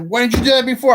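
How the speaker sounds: mock exasperated homeowner. Homeowner demanding why he didn't serve earlier, mock exasperated. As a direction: toss his complaint back, exaggerated. Angry homeowner voiced in retelling.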